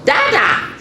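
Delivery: high, emphatic sheep voice; eager sheep prompting its lamb; sheep parent. A narrator's reading. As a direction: call it out high as a coaxing sheep. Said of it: "says the sheep".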